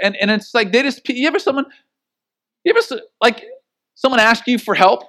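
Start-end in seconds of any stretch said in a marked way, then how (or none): none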